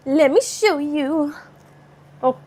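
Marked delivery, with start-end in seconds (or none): none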